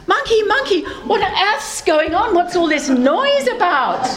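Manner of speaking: high-pitched, exasperated demand; alarmed calling out. Acted. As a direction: come running in, demanding to know. Platypus runs down to the noise.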